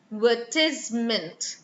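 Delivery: "advertisement" broken into separate syllables; demonstrated word stress. Shown as a demonstration. Teacher showing where stress falls, syllable-by-syllable "advertisement". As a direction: spell out "advertisement" piece by piece, ad-ver-tise-ment. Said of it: "the one where the stress is".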